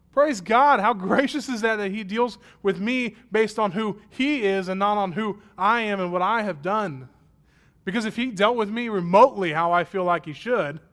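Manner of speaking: high, loud preacher fire; fervent preaching. Acted. Pastor exclaiming God's grace to congregation, fervent preaching. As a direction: lift the church in praise.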